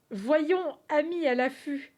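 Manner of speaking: loud, grand reading of the riddle; loud dramatic read. Shown as a demonstration. Streamer reading the riddle aloud to chat, loud and grand. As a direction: boom it out like a proclamation. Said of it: "On recommence".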